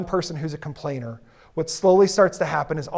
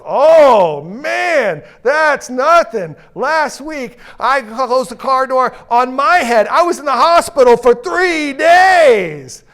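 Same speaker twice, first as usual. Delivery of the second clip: high, loud, slow boastful whine; one-upping coworker; 7 semitones higher, 9 dB louder, much slower than usual. Retold or imitated. Coworker outdoing Bob's head injury story, in a high, loud one-upping wail. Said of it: "And the guy goes".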